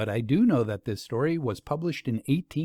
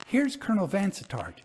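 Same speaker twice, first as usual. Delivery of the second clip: eager cry; 6 semitones higher, faster than usual. Acted. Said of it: "she cried".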